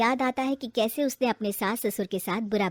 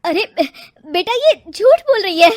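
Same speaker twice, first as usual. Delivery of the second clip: loud protest; 7 semitones higher, 11 dB louder than usual. Acted. Mother pleads with son.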